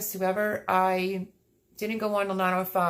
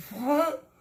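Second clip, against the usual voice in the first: surprised what; 8 semitones higher than usual. Real, not acted. Shocked by a gift.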